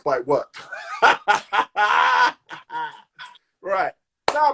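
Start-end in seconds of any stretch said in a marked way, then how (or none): 0.6-2.9 real, hearty cackle of amusement. Real, not acted. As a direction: burst out laughing at yourself. Laughs at own odd accent.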